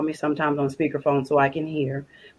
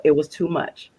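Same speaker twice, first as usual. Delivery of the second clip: ordinary talk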